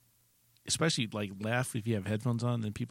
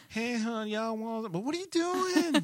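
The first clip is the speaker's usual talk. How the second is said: high-pitched Southern drawl, mock wife; hammed-up Arkansas twang, pitched way up; wife's Arkansas accent; 11 semitones higher, slower than usual. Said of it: "for that accent to fall off of my wife".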